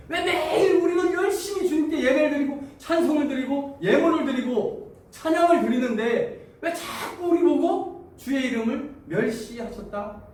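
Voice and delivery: high, loud, laughing protest, slowing at the end; indignant complaint with a laugh. Acted. Israelites protesting their worship to God, laughing indignation. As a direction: scoff at the accusation. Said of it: "이렇게 따집니다".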